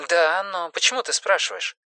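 high, soft female character on the line; voice on the phone. Acted. Simone notices Erik sounds uneasy, high soft phone voice. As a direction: ask softly and high through a telephone. Симона говорит по телефону.

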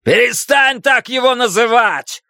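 high, forceful shout; angry yell. A narrator's reading. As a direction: yell it to cut her off. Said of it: "заорал Большой Джим".

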